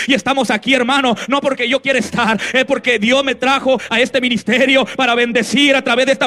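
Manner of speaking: loud, high fervent preaching; full-force preaching. Acted. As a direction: preach it out to the crowd. Preacher calls for applause.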